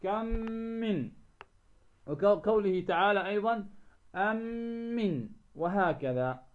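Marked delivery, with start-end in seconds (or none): 0.0-1.1 كم من as one word with a long hummed m; idgham of كم من. Shown as a demonstration. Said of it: "ندغمهما". 4.1-5.3 أم من run together on a held humming m; idgham of أم من. Shown as a demonstration. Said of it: "ويسمى إدغاما صغيرا".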